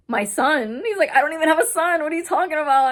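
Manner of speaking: loud, high-pitched imitation of disbelief; incredulous retelling. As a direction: act out a man baffled by the claim. Acting out man's disbelief at son.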